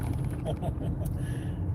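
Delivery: breathy mocking chuckle; scornful laugh. Real, not acted. Reacting to the speech, a scornful laugh. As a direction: laugh it off in disbelief.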